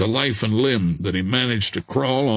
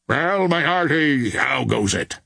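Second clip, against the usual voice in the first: sailor's greeting; 3 semitones higher than usual. A narrator's reading. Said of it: "asked the stranger".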